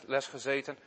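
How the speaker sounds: plain reading voice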